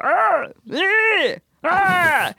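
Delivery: high, drawn-out squawked vowels; puppet-like cries. Retold or imitated. Imitating neighbors heard through the walls, high puppet-like cries. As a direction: squawk wordless puppet noises. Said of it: "through the walls so often hear".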